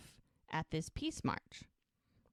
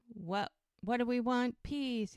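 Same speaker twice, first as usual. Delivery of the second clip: mild protest chant, pitched higher; mock crowd yell; 6 semitones higher, slower than usual. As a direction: imitate yelling along with marchers. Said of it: "she's yelling with the crowd".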